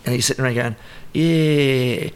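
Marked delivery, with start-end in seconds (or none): 1.1-2.1 "Yeah" drawn out long and slow; stalling for thought. Retold or imitated. Showing a habitual filler while sitting around, stalling. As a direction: stretch "Yeah" while hunting for words. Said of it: "I'm trying to think of what to say".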